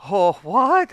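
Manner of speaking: high, loud mock shock on what. Party members react in shock to the healer. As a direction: shoot it up in disbelief. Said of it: "And they're like".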